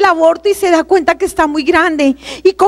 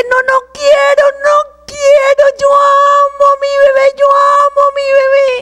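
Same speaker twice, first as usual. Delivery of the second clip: retold shout; 5 semitones higher than usual. Shouting at the boyfriend who wants an abortion, a desperate cry. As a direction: shout the refusal at her boyfriend as if it were happening again. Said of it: "Yo le grité".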